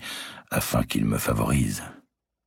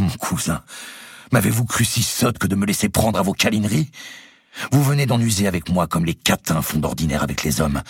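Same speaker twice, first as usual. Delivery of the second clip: angry; 8 semitones higher than usual. Acted. Marguerite exposing her cousin's trick, angry and scornful. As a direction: lash out at him with contempt. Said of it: "les yeux brillants de colère".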